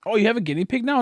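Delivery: loud, high surprised question; delighted surprise at the news; loud high excited question. Real, not acted. Surprised at new guinea pig.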